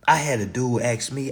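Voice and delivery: normal talking voice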